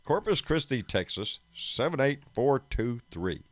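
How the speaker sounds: formal radio announcer read. Shown as a demonstration. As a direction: read the address like a station announcer. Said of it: "That's my announcer voice".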